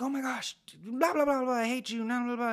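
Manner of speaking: hammed-up blowup at someone; losing your temper in a pretend argument; mock angry yell. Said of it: "you yell at somebody and storm off".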